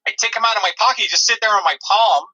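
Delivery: usual speaking voice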